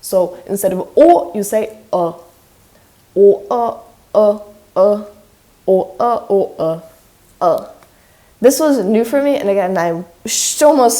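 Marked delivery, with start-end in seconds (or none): 1.9-7.7 uh as an O with unrounded lips. Shown as a demonstration. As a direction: alternate O with uh, its unrounded twin. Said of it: "it's the unrounded version of O".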